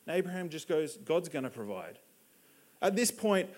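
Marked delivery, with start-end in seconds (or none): 1.0-2.0 low, softly voiced assurance; calm trust. Abraham reassuring his son on the mountain, calm and low. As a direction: answer quietly, with simple faith.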